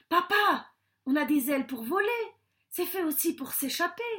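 high, loud, eager appeal; urgent pleading. Acted. Son begs his father to flee, urgent and high. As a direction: press him with youthful urgency.

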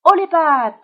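loud, high stick-'em-up call; hold-up shout. A narrator's reading. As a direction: shout it out like a little bandit. Billy hold-up on passing rabbit.

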